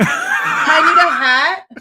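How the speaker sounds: slow, laughing "I need a hat"; laughing, drawn out. Real, not acted. Planning the costume while laughing, slow and drawn out. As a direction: stretch "I need a hat" out slowly through a laugh.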